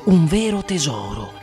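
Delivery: ordinary delivery, nothing marked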